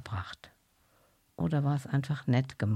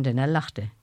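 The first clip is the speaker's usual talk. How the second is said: plain reading voice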